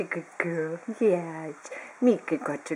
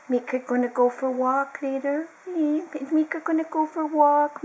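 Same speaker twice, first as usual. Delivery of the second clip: lightly raised sing-song pet voice; 8 semitones higher, 4 dB louder than usual. Real, not acted. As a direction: tease the dog about a walk. High pet talk to the dog.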